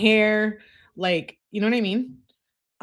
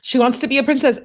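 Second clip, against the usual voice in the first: ordinary talk, nothing marked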